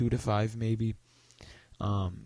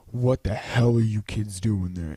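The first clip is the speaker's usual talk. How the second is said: imitating an angry man, louder; mock angry yell; 6 dB louder than usual. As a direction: tell off some kids in a half-shouted retelling. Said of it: "he starts screaming at us".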